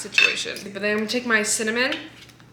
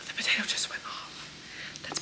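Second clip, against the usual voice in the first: whispering; 16 dB quieter, much faster than usual. Real, not acted. Whispering aside while cooking.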